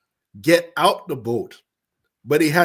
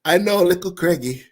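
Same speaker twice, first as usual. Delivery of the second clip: light Jamaican accent, unhurried and emphatic; half Jamaican accent. Shown as a demonstration. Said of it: "say this in my half Jamaican accent".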